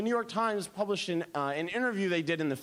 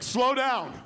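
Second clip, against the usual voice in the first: louder, higher exclamation; amazed interruption; 4 semitones higher, 5 dB louder than usual. Retold or imitated. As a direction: cut in, overwhelmed by genius. Amazed room interrupts her.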